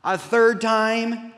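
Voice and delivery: high-pitched, drawn-out exasperation; hurt, incredulous whine rising to a loud finish; distressed protest. Acted. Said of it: "he's distressed or he's grieved".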